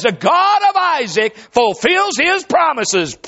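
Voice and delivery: high, drawn-out proclamation; triumphant shout. Acted. Reporter relays Abraham's triumphant answer.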